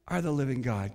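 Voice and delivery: low, soft landing; sudden hush. Acted. Disciples confess the living God, suddenly hushed and low. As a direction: drop right down and let it settle reverently. Sudden drop after the climax.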